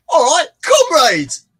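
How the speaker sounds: loud, high Cockney rallying cry; Cockney accent. Acted. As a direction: call out slowly in broad Cockney. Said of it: "who can do a Cockney accent then".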